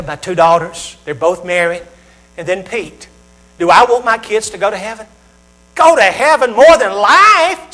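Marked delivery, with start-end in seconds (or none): 5.7-7.7 high, loud and slow; preaching shout. Real, not acted. Father of three shouting his yes to the crowd. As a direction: shout it out as the answer. Preacher answering own question.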